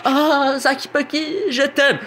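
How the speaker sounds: squeaky high love-struck voice; high girly voice. Acted. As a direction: swoon in a high falsetto.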